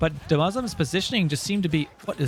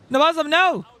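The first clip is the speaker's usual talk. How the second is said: sudden high shout of alarm; excited cry; 9 semitones higher, 5 dB louder than usual. Real, not acted. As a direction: yell it as the ship goes down. Tense naval fight, caster cries out.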